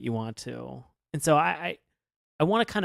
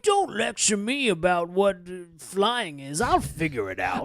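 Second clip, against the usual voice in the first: slow, emphatic mock indignation; hammed-up touchy protest; testy retort; 3 semitones higher than usual. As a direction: draw it out like someone offended. Acting out Gimmick's testy retort.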